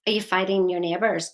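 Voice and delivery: ordinary delivery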